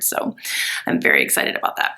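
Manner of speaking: dry unvoiced whispered aside; fast whispered aside. Real, not acted. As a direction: slip it in as a quick secret. Aside about hair appointment.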